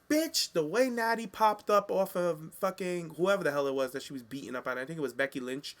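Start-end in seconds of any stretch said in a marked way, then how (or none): 0.1-0.5 shrieked high bitch; sassy exclamation. Retold or imitated. Imitating Natty reacting to Naomi's music, pitched way up. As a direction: fling it out in mock outrage. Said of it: "Natty's like".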